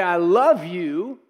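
slow, drawn out, empty I love you; hollow mock I love you. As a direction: say it like you don't mean it.

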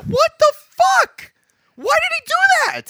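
high, loud, drawn-out outburst; outraged disbelief. Real, not acted. Listener reacting to the film's twist, outraged disbelief. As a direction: shout it slowly, truly baffled.

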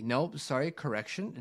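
ordinary talk

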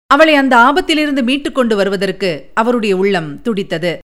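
plain reading voice

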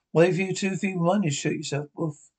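ordinary talk

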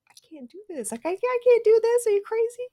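rising from hushed to high, loud alarm; shocked at herself, voice shooting up; catching herself about to hit the child; panicked. Retold or imitated. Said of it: "I was like".

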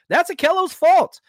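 heated rant, high and loud; angry outburst. Real, not acted. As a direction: blame him with a loud burst. Ranting about a former player.

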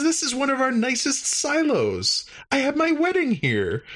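very high, beaming alien voice; proud alien. Retold or imitated. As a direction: pitch way up, proudly showing off. Klar proudly shows silo.

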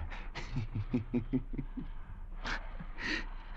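gloating laugh. Acted. The sailor gloats over the doctor.

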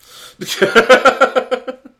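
loud high-pitched burst of laughter. Real, not acted. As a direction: cackle loudly and high.

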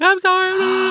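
put-on high, loud, wailing sorry like a crying kid; hammed-up sobbing apology; mock bawling. Caught little girl apologizing to her mom, mock bawling. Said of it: "And I was bawling".

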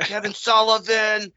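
high, slow character voice; Kevin Sullivan. Acted. Podcaster announcing himself as the wrestler, high slow impression. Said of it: "that's my Kevin Sullivan impression".

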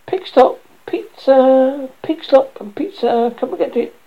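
high, drawn-out hawker's cry; vendor's call. Acted. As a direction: call customers over for pizza. Boy calls out selling pizza.

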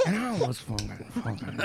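quiet intense. Retold or imitated. Cohost mimicking Steve explaining his sex life, getting quiet. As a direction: imitate him dropping low and quiet. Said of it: "He gets quiet".

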